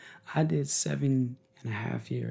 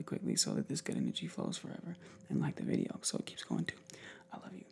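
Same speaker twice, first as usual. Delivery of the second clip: much lower, soft whisper; ASMR whisper; 13 semitones lower, 5 dB quieter, faster than usual. Real, not acted. Vlogger asking for likes, soft ASMR whisper. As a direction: whisper it close and low. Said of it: "I get my A S M R on".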